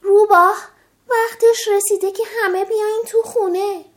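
Fox's mother calling everyone inside. Acted. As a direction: call the kids in from afar. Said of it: "مادر روباه فریاد زد".

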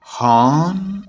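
long, drawn-out puzzled "huh?"; anxious, confused questioning; worried. A narrator's reading. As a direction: stretch it out uneasily, rising at the end.

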